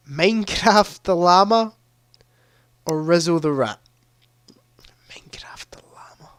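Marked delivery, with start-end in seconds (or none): 5.1-6.3 breathy whisper, barely audible. Acted. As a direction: whisper it like a secret. Repeats silly answer, whispered.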